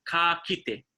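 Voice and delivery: "ka kite" said slowly, each syllable clear; teaching the farewell slowly; slow pronunciation. Shown as a demonstration. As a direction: spell out "ka kite" at a crawl. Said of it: "Two words".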